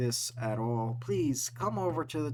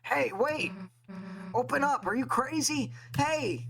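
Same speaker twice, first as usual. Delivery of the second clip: high panicked shouts; frantic yelling; 10 semitones higher than usual. Acted. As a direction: bang on the door and yell. Characters pounding on a door.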